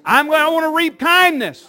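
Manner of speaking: loud, rallying call to the congregation; fervent church shout; preacher's declaration. Real, not acted. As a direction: call it out for the crowd to repeat.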